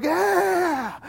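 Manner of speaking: mock crowd going wild in a high voice; crazed cheer. First section going all the crazier, excited cheer.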